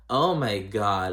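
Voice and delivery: ordinary delivery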